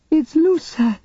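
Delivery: put-on high, alarmed child voice; a girl recognising her friend in danger; child crying out. Jaina spots Lusa, high alarmed child. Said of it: "Jaina cried".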